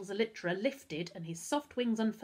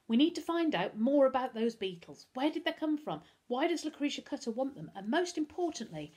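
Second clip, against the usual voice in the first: rising agitation in a higher voice; getting angry; 7 semitones higher, 3 dB louder than usual. A narrator's reading. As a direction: fire off the questions, a touch angry. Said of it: "Darcus felt himself getting angry".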